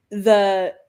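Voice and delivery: ordinary delivery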